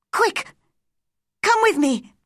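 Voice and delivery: high, frantic urging; urgent panicked command; screamed. A narrator's reading. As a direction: cry out to hurry them along. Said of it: "she screamed".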